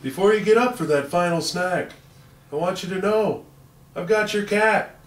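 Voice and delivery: high-pitched excited Christmas elf; squeaky, wide-eyed holiday elf cheer; Elf impression. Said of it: "my Elf voice".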